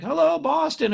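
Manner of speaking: rock star greeting. Retold or imitated. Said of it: "they'd be like".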